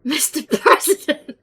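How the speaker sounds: giggling Mister President; laughing. Real, not acted. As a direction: laugh through the words. Callback said laughing.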